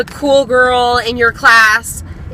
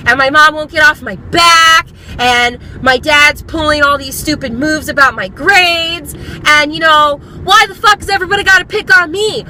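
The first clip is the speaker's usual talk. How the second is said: furioso, quejumbroso; 5 semitones higher than usual. Acted. Mock angry rant of complaints.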